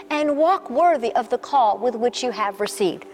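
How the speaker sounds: normal talking voice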